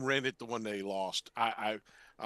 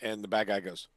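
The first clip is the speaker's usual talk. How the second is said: ordinary delivery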